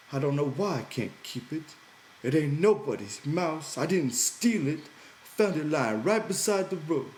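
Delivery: plead, close to tears; voice breaking a little. A narrator's reading. Lenny giving up the mouse to George, voice breaking a little. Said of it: "His voice broke a little".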